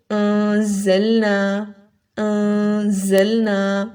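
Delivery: انزلنا very slowly with a buzzing whistled z; whistling za. Shown as a demonstration. Teacher gives example of za, slow انزلنا with whistled z. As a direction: stretch انزلنا out, sharp z in the middle. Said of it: "زا پڑھتے ہوئے بھی سیٹی کی آواز نکلے گی".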